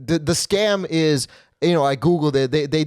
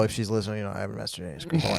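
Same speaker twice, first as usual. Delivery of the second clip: rushed low aside, much softer; quick quiet aside; 7 semitones lower, 8 dB quieter, much faster than usual. Real, not acted. Rushed quiet aside to his girlfriend, in case she's listening. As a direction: slip it in under your breath, fast.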